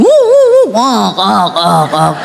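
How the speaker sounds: mock engine dying, pitch falling away; engine sputtering. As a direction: mimic an old car stalling on a hill. Imitating car engine dying.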